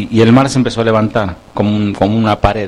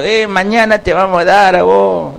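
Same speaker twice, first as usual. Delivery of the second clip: a hint of a jeering soldier, higher; taunting enemy; 6 semitones higher than usual. Enemy soldiers shouting threats at Israel, lightly voiced jeering. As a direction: toss the threat across the camp. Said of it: "el enemigo que seguía diciendo".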